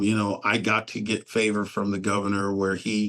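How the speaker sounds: ordinary talk, nothing marked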